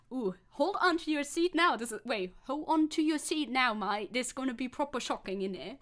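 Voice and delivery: playful put-on British voice; light British accent, teasing; mock British accent. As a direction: tease them in a hammed-up London accent. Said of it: "talk British to him".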